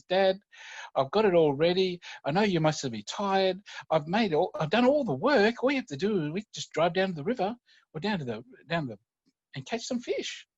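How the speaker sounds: put-on high eager child's plea; hopeful little boy. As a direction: coax your dad like a hopeful kid. Son pleading with tired dad.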